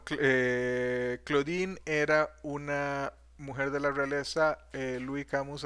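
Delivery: ordinary talk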